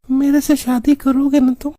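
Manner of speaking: put-on high girlish phone voice; coy young woman voice; man doing a woman's voice. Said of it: "तुम्हारी आवाज तो बहुत अच्छी है".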